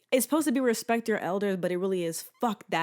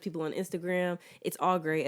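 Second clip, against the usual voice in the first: normal talking voice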